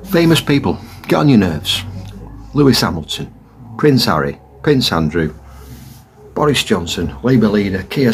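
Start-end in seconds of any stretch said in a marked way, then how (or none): none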